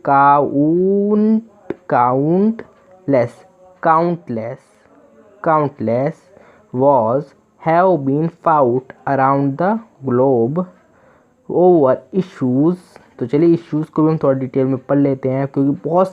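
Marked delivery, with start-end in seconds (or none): 0.0-3.4 count stretched out slowly, then countless drawn out; sounding out a word for a class; slow syllable-by-syllable read. Shown as a demonstration. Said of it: "तो क्या बना?". 6.7-12.8 English read out very slowly, word by word; teacher dictating a sentence; slow reading aloud. Shown as a demonstration.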